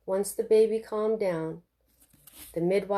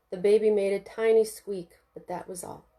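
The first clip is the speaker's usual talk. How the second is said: ordinary delivery, nothing marked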